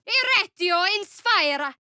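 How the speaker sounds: high, declaimed spell words; spell incantation. Acted. Caster hurling a spell at foes, declaimed incantation. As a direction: intone the spell with force.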